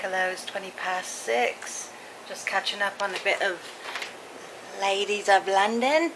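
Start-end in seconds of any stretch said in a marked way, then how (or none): 4.8-6.1 slow, posh London drawl; posh English accent. Retold or imitated. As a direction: put on a plummy English accent. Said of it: "No, they don't speak like that at all".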